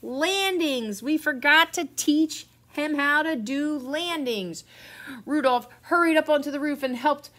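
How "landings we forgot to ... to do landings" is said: higher, drawn-out cry of alarm; storybook shout. A narrator's reading. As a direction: call it out high, stretching the key words. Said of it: "They shouted together".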